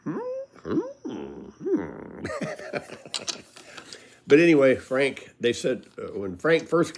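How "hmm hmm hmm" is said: puzzled little raccoon hums, quiet and odd. Retold or imitated. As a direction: hum like a baffled raccoon. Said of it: "that raccoon would go".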